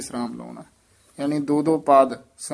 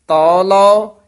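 ਤਉ ਲਉ lifted higher and loud, held; thamak hold; 4 semitones higher, 7 dB louder, slower than usual. Shown as a demonstration. Teacher marking the thamak on ਤਉ ਲਉ, high and loud. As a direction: hit ਤਉ ਲਉ high and strong before the rest. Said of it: "ਇੱਥੇ ਠਮਕ".

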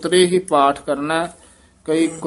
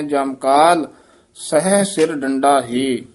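ਜਮ ਕਾਲ set apart by a break; pause after ਕਾਲ. Shown as a demonstration. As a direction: clear halt after ਜਮ ਕਾਲ. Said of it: "ਪਦ ਤੇ ਯਮਕ ਲਾ ਦੇਣੀ ਹੈ".

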